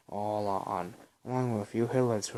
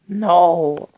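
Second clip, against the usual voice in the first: full-force high shriek; one piercing desperate scream; screaming at the top of her lungs; 8 semitones higher, 20 dB louder than usual. A narrator's reading. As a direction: tear the word out with everything she has. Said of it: "She screamed at the top of her lungs".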